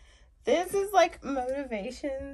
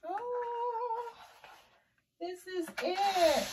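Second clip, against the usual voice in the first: excited; 10 semitones higher, much slower than usual. Real, not acted. Unboxing her photo order.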